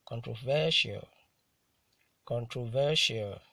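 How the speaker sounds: "controversial" with VER hit harder; careful model reading of "controversial", stressed on VER; penultimate stress. Shown as a demonstration. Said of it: "stressed on the penultimate syllable".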